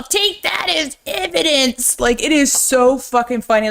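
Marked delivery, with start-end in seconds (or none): 0.0-2.0 high-pitched mock sheriff voice; imitated sheriff. Retelling the sheriff losing his door, high mock outrage. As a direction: pitch it way up as an exasperated movie sheriff. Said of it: "He's all".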